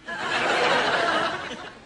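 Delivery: high, loud burst of laughter; sustained high-pitched cackle. Acted. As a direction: laugh loudly at your own joke. Laughs at own accent joke.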